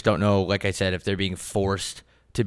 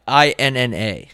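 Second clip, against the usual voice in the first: ordinary talk, nothing marked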